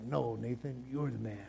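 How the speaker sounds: a hushed, kidding return of praise; mock flattering reply. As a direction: hand the compliment back, low and soft, as a joke. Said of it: "David probably turns around and is like".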